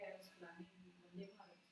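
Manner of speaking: normal talking voice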